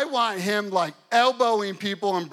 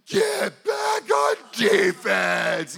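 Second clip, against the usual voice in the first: frantic coach screaming orders; 2 semitones higher, 7 dB louder, much slower than usual. Retold or imitated. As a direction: bellow it slowly across the whole gym. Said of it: "and I'm screaming".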